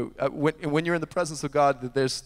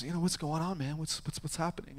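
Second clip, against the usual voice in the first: softer, gentle question; soft gentle asking; 9 dB quieter than usual. Retold or imitated. Brett gently asking the man on the floor, softly. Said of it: "Brett goes".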